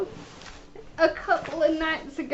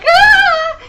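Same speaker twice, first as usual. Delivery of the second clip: a much higher, far louder hammed-up scream; mock scream; 13 semitones higher, 15 dB louder than usual. Caller reenacts her shriek at the mouse for the agent. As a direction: shriek it like you saw the mouse again. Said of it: "And I was like".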